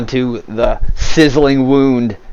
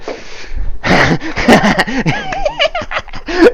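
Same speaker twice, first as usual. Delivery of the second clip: high-pitched gleeful cackling; villain's cackle. Acted. Anzi standing over the fallen fighter, cackling. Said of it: "just standing there cackling".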